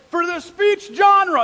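booming award announcement; announcer. Retold or imitated. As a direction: draw it out like a ceremony host.

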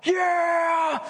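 long, high hyped-up shout; mock cheer. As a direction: whoop like a pumped-up new recruit. Mock cheer for the iPhone prize.

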